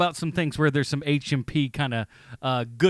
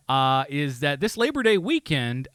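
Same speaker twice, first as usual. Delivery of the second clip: usual speaking voice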